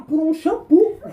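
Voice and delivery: usual speaking voice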